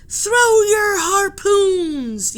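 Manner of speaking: high, very loud, slow order; rally the men to attack; fierce yell. Acted. Sailor yells to the crew, high, very loud and slow. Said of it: "yelled a sailor".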